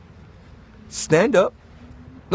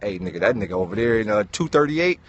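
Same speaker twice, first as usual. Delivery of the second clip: gossiping neighbor; 8 semitones lower than usual. Retold or imitated. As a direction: murmur it like a nosy neighbor. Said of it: "everybody in the neighborhood like".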